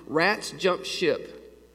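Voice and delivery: drawn out very slowly, ominous; slow grave warning. Retold or imitated. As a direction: spell out the old sailor's warning slowly.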